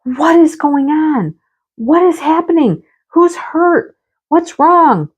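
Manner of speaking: high, alarmed rush of questions; frightened, high-pitched what's going on; panicked questions. Retold or imitated. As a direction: ask in sudden fright. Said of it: "And I'm like".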